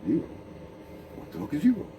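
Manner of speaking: "Zero?" asked with a puzzled rise; a light mock of disbelief at "Zero?"; baffled question. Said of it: "And you're like".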